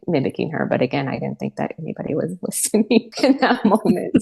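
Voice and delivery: ordinary talk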